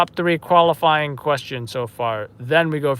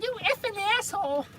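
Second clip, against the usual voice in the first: a much higher, put-on voice for Carmela, cursing at her husband; Carmela Soprano yelling; 10 dB quieter than usual. Retelling a Sopranos scene, Carmela cursing Tony, high outraged mock voice. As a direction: squeal the insult as an outraged Carmela. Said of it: "she's like".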